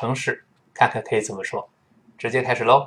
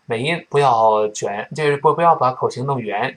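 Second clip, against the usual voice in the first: normal talking voice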